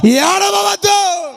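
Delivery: slow, high, crying shout of don't cry; tearful shout. Real, not acted. Preacher crying out to the weeping congregation, tearful shout. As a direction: cry it out with breaking voice.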